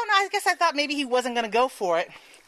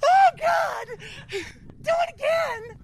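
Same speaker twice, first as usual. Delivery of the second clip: much higher, slow, begging for more; eager begging; 13 semitones higher, much slower than usual. Retold or imitated. Retelling her thrilled plea to her partner, high and slow. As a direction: beg breathlessly for another go. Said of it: "or you could be me and go like".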